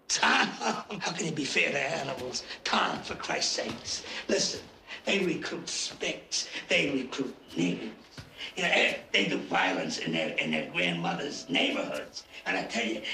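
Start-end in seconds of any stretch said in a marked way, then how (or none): none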